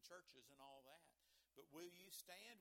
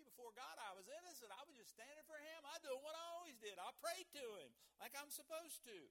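fervent Daniel; 7 semitones higher than usual. Retold or imitated. Preacher voices Daniel's innocence.

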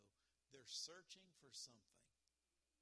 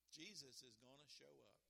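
normal talking voice

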